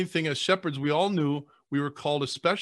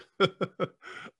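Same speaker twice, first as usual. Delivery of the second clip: laughing; 5 semitones higher than usual. Real, not acted. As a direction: let the laugh fade out. Laugh trails after compliment.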